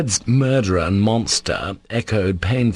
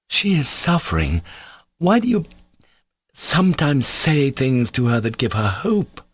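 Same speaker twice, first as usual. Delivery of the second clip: halting, higher-pitched reproach; timid reproach; 6 semitones higher than usual. A narrator's reading. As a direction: reproach a brother hesitantly, slowing down. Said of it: "went on with timid reproach".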